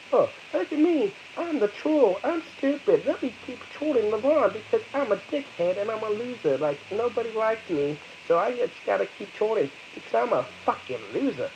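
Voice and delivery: mock the trolls like a silly cartoon. Mocks trolls in their voice.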